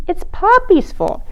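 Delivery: put-on blaming child. Katie blames panda.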